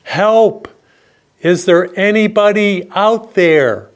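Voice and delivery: high, drawn-out call into the distance; yelling for help. Acted. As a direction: call out desperately, like a man stranded and alone. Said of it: "decided to yell out".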